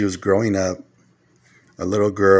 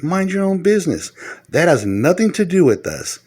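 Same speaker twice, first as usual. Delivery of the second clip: raised retold rebuke; 9 semitones higher than usual. Repeats it, raising voice.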